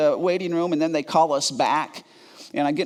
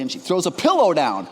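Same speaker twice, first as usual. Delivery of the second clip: ordinary delivery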